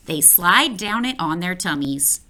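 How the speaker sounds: usual speaking voice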